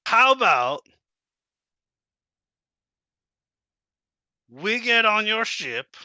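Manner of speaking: high, eager kobold. Acted. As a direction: pitch a plan like a kobold. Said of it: "and he's like".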